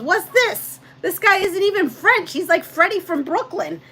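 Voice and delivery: high, loud mock indignation; mocking husband. Husband mocking the teacher's fake French accent, high indignant scoff. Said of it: "This guy comes on and he goes".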